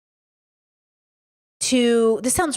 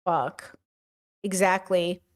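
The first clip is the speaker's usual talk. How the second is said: ordinary talk, nothing marked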